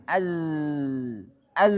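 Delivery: "Az" with a long buzzing z; sustained, unstopped z on "Az"; flowing zai. Shown as a demonstration. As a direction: hold the z at the end of "Az".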